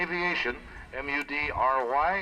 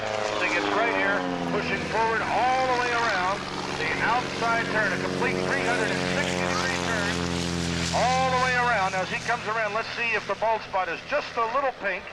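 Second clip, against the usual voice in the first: higher, louder as the turn peaks; excited commentary; 6 semitones higher, 4 dB louder than usual. Real, not acted. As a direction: build the excitement round the loop. Excited climax of outside turn.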